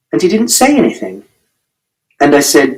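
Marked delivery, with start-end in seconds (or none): none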